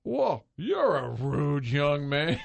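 Harry Caray. Retold or imitated. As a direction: stretch it out like a gruff old announcer. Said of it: "looks over at me and says".

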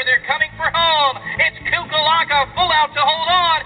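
rising to the finish line; excited. Real, not acted. Stretch run to the wire.

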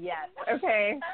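lightly voiced, slow, knowing yes; a hint of Carolyn May's emphatic approval; Carolyn May impression. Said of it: "I feel very Carolyn May saying this".

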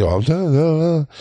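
lightly mocked clerk's protest; mock flustered clerk. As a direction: imitate a clerk brushing it off. Retells the clerk's flustered reply.

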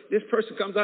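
ordinary talk, nothing marked

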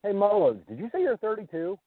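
ordinary talk, nothing marked

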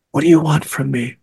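restrained, held-in demand; retold inward scream. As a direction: plead under your breath, a mock scream kept inside. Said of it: "I'm screaming inside my head".

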